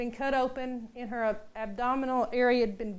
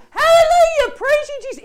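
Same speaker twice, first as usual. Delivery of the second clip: much higher and louder, a sudden burst of praise; funny Hallelujah outburst; 13 semitones higher, 15 dB louder than usual. Retold or imitated. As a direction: blurt out praise in a startled woman's voice. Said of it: "It sounded so funny coming out of her mouth".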